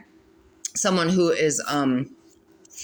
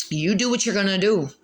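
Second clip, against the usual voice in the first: ordinary talk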